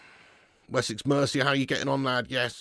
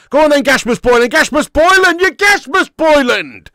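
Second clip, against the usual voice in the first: loud, high, outraged yelling of the name; furious shout; 11 semitones higher, 11 dB louder than usual. Real, not acted. Fan yelling at his team's striker, furious shout. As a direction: bellow the name in disgust.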